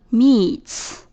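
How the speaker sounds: "meets" ending in fused ts; merged ts ending. Shown as a demonstration. Teacher adding S to meet, "meets" ending in ts. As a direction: close "meets" on one voiceless ts sound. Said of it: "共同发音是呲".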